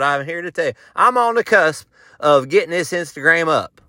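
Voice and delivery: normal talking voice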